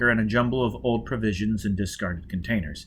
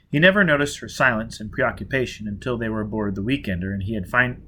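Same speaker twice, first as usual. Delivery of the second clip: plain reading voice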